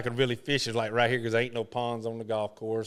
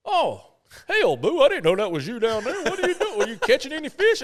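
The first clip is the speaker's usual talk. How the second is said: high, laughing, louder friendly greeting; Arnold Palmer laughing; 11 semitones higher, 5 dB louder than usual. Retold or imitated. Palmer recognizing Boo and laughing, high and louder. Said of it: "And he goes".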